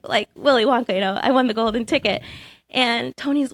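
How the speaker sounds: usual speaking voice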